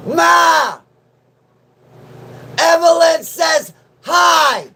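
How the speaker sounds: loud, high call to another room; shouting to mom. Real, not acted. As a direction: yell it up the stairs to mom. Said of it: "She said hi".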